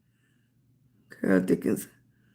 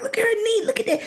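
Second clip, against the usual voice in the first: put-on excited kid; 12 semitones higher, much faster than usual. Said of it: "He was like".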